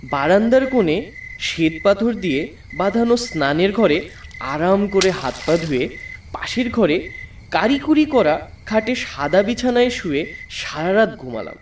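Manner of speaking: ordinary talk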